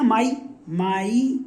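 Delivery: माई, माई stretched slowly, syllable held; slow sounding out. Shown as a demonstration. Teacher stretching माई for pupils to hear the vowel. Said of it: "आई आ रहा ना".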